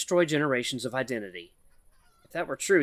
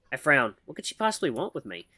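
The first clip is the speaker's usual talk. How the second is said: ordinary delivery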